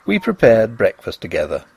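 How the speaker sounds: usual speaking voice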